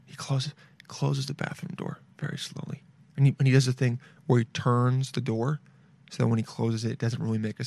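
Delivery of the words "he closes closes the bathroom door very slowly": hushed spooky whisper. Real, not acted. Narrating the murderer closing the bathroom door, hushed whisper. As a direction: breathe it like a secret.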